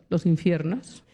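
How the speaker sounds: ordinary talk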